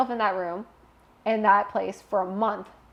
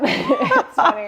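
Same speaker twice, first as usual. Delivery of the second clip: breaking into laughter mid-line; laughing; 11 dB louder, slower than usual. Real, not acted. Laughing with the host about not asking her daughter. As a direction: let the laugh carry the words.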